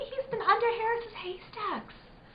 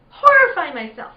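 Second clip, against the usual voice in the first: much louder burst on horrify myself; sudden outburst; 3 semitones lower, 12 dB louder than usual. Acted. Girl confessing why she fired, sudden loud outburst. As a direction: blurt it out hard.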